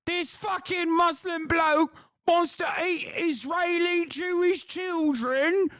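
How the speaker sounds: high, slow, over-the-top shouting caricature; mock tabloid rant. Mocking Daily Mail writers with a shrieking high caricature. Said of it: "Daily Mail will just be like".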